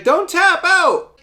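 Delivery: high, loud mock daughter yell; shouted imitation. Daughter shouting to her dad in the fight, mock yell. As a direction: yell it high like a frantic kid at ringside.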